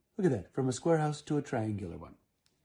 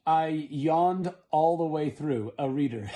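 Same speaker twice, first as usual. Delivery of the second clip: mock bored review; 2 semitones higher, 7 dB louder, slower than usual. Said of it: "it says, with the review".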